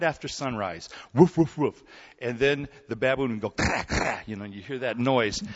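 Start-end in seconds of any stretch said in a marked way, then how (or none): 1.1-1.8 louder mock barking, "Woof" punched; mock dog barking. Imitating the neighbor's dog again. 3.5-4.2 lightly imitated baboon "Kra" call.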